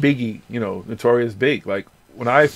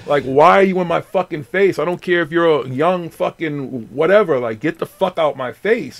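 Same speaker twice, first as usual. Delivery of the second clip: retold annoyance; 5 semitones higher, 6 dB louder than usual. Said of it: "I'm like".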